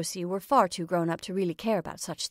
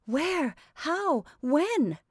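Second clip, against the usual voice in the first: startled questions; 9 semitones higher, much slower than usual. A narrator's reading.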